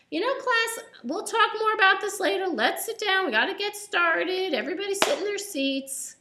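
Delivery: a teacher calling the class to order, pitched high. Acted.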